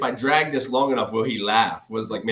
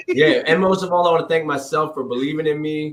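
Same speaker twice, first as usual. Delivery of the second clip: mock acceptance speech; 5 semitones higher, 6 dB louder than usual.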